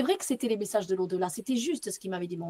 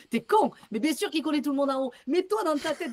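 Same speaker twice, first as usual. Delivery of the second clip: normal talking voice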